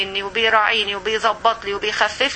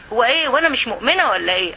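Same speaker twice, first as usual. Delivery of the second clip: ordinary talk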